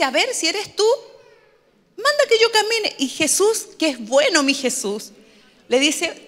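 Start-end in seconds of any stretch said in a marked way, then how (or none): 0.0-3.0 doubting challenge in a raised pitch; Pedro desafiante. Retold or imitated. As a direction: mildly act out a high-pitched dare. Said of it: "lo pone en duda y le dice". (desafío incrédulo, en tono agudo)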